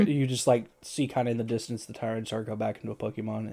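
ordinary talk

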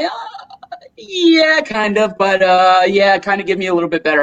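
high laugh breaking into an embarrassed yeah; sheepish laughing admission. Retold or imitated. Caught not knowing geography, laughing sheepishly to his friend. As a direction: laugh it off, pitch up, then rush the end. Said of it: "I'm like".

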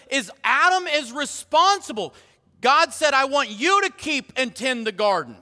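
high-pitched, insistent preacher's charge; emphatic preaching. Real, not acted. God charging Adam with his duties, emphatic preaching. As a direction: preach each command with force.

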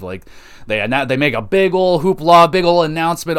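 normal talking voice

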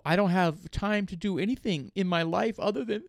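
mock-tearful, slightly high complaint; imitated crying complaint. Co-host mimics a new graduate upset about commuting, mock crying. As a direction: imitate a tearful whine, only lightly. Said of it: "She was crying, crying that".

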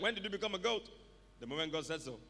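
normal talking voice

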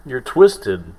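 angry shout. Retold or imitated. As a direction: yell it in outrage. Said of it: "And then Batman's like".

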